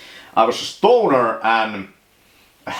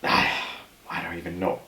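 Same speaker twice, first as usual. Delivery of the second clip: defeated sigh; 7 semitones lower, 9 dB quieter than usual. Real, not acted. Sighs, gives up describing home.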